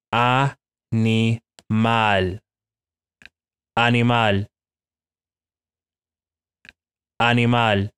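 animal as ah-nee-MAHL with open Spanish vowels; Spanish pronunciation. Shown as a demonstration. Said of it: "how it's actually pronounced".

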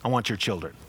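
put-on the devil, low and soft, wanting the kids; lightly voiced devil, low and hushed; low, quiet devil voice. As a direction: murmur it low like a tempter. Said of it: "what the devil would always say".

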